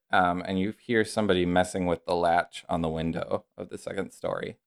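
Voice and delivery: normal talking voice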